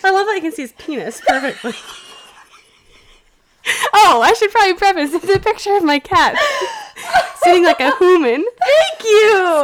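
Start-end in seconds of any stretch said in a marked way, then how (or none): 3.9-9.6 high, loud delighted thanks; delighted opening a present. Real, not acted. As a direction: gush over the gift.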